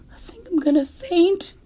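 high, unvoiced panicky whisper; faint whisper. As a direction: gasp it out as if about to faint. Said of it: "he whispered".

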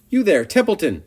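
high, loud call to someone nearby. A narrator's reading. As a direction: hail the rat across the yard. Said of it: "he called".

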